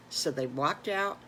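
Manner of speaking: usual speaking voice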